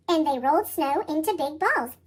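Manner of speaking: ordinary delivery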